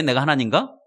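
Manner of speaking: ordinary talk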